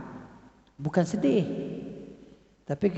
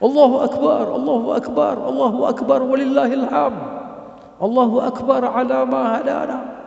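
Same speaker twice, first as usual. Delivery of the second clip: higher and louder, celebratory; joyful takbir; 6 semitones higher, 3 dB louder than usual. Shown as a demonstration. Teacher repeating the joyful takbir for the class, raised and bright. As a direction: proclaim it gladly. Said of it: "Boleh juga nak takbir macam kita punya lafaz".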